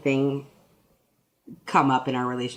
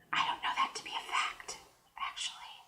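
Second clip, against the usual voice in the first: whispered aside; 13 dB quieter than usual. Real, not acted. Whispered aside about fish.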